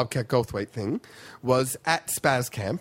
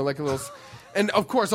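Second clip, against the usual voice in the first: usual speaking voice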